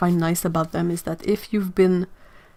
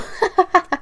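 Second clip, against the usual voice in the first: high giggle; 12 semitones higher, 4 dB louder than usual. Real, not acted. Laughing at chat comment.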